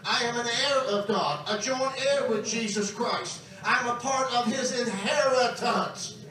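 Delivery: emphatic preacher building to full force; ringing sermon climax, each phrase driven home; impassioned preaching. Real, not acted. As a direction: declare it to the congregation, rising in pitch.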